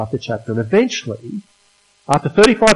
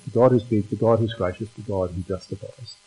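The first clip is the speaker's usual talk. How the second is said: softer recap; 6 semitones lower, 8 dB quieter than usual. Shown as a demonstration.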